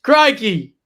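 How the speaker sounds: loud, high Aussie exclamation; Australian accent. Shown as a demonstration. Demonstrating an Australian accent on request.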